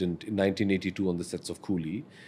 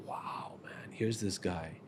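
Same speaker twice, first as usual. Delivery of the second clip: near-whispered amazement; very soft, low-key awed aside; hushed awe; 3 semitones lower, 14 dB quieter, much slower than usual. Retold or imitated. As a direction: drop right down in quiet wonder. Relives his awe at father's answer.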